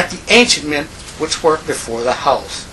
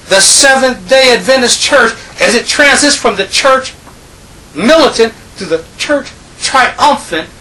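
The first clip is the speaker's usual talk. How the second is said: raised, driving sermon voice, pressing each point; fervent preaching; 6 semitones higher, 4 dB louder than usual. Real, not acted. Preacher rousing his congregation with a rising, fervent voice.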